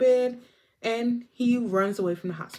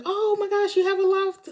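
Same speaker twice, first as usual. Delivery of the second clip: much higher excited squeal; high giddy girl voice, hammed up; mock ditzy gush; 13 semitones higher, 4 dB louder than usual. Crazy girl gushes.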